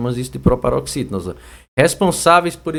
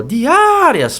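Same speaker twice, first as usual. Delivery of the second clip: raised, stretched A in "diárias"; calling-out demo; 10 semitones higher, 5 dB louder than usual. Shown as a demonstration. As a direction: call out "diárias", holding the A long. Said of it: "chamando a palavra pelo portão". (chamando, esticando o A)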